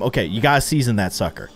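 ordinary delivery

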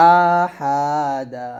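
"আহাদা" with each vowel stretched long; drawn-out wrong reading. Shown as a demonstration. Teacher warns pupils against this reading, slow stretched vowels. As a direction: drag out every syllable of "আহাদা". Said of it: "এরকম টেনে পড়বেন না".

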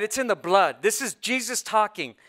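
ordinary delivery

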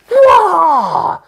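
high, loud, gasping awe; high, loud awestruck cries half laughing; overwhelmed exclamation. Retold or imitated. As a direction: burst out in high overwhelmed wonder. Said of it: "and it's just".

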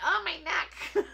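high, drawn-out mock yelp with a laugh; retold ouch, hammed up; mock complaint. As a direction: whine it up high, half laughing. Retold yelp while hair is done.

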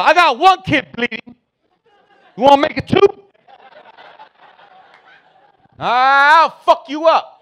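high, loud strained yelling; dad's angry threat. Retold or imitated. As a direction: threaten the kid at full pitch. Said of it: "So now I'm mad".

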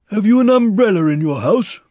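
high, loud bear voice; calling down. A narrator's reading. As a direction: call the question far below. Pooh calls down a question.

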